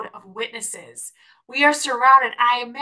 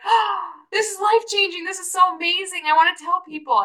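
high, gushing burst of wonder; high-pitched eager amazement; excited realization; 7 semitones higher than usual. Retold or imitated. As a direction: retell a thrilled discovery after a gasp. Excited retelling of getting saved.

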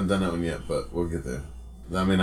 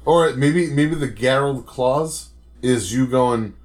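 ordinary talk

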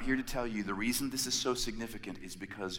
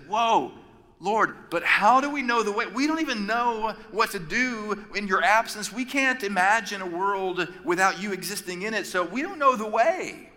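anxious Thomas; 7 semitones higher, 4 dB louder than usual. Retold or imitated. Thomas voiced in retold scene.

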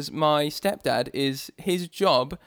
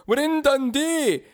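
high, slow Scottish put-on; playful, drawn-out Scottish lilt; mock Scottish accent; 10 semitones higher, slower than usual. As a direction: call it out in a daft Scottish accent. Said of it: "we're gonna be trying this accent".

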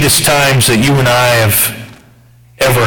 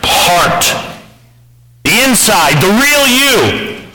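higher, intense pulpit voice; fervent preaching; 6 semitones higher than usual. Acted. Preacher pressing the congregation, higher and fervent. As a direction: drive the point home to the congregation.

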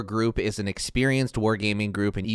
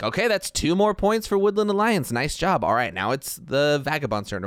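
upbeat game-table announcer, higher at the start; 5 semitones higher than usual. Acted. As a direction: call out the score like an encouraging announcer. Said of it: "kinda be the announcer a little bit".

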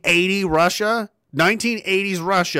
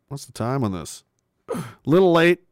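ordinary delivery

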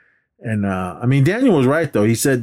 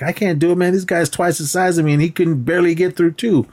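higher-pitched acted complaint; retold pleading, pitched up; mock protest; 8 semitones higher than usual. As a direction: play the doubting kid objecting to his coach. Voices Daniel objecting to Miyagi.

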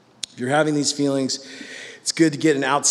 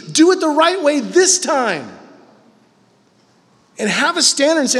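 louder, higher preaching build; 8 semitones higher, 4 dB louder than usual. Acted. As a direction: fire it up like a preacher. Preaching grace, rising intensity.

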